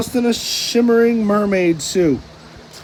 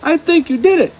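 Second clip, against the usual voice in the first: very high, louder cheer; much higher joyful shout; overjoyed cry; 10 semitones higher, 4 dB louder, faster than usual. A narrator's reading. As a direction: burst with pride. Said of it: "SpongeBob cried".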